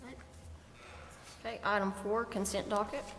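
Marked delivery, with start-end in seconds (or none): none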